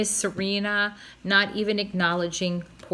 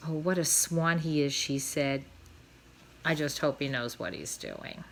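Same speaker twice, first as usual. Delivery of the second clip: worried murmur; 7 semitones lower, 6 dB quieter than usual. A narrator's reading. Wife watches him leave.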